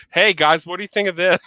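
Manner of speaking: loud, high call to the meeting; imagined shout at a work meeting; shouted interruption. Retold or imitated. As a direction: yell it out to a boring board room. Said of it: "I'm like".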